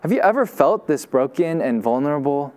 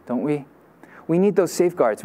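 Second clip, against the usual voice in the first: ordinary delivery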